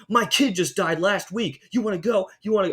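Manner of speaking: imitating a woman picking a fight; a hint of a belligerent stranger; aggressive challenge. Lady picking fights in restaurant.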